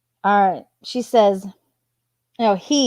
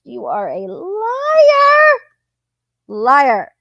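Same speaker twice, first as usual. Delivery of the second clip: high, loud furious yell of liar; real anger at her lies, drawn out; angry outburst; 10 semitones higher, 5 dB louder, much slower than usual. Real, not acted.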